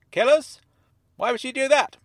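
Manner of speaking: high, loud disbelieving questions; scoffing. Acted. Dennis dismissing the narrator's fear of danger, loudly incredulous. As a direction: make the fear sound absurd.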